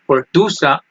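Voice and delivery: usual speaking voice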